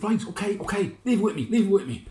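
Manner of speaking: high, loud, hurried mayor brushing them off; flustered Lord Mayor. Acted. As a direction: placate the crowd in a high loud voice. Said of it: "And he said".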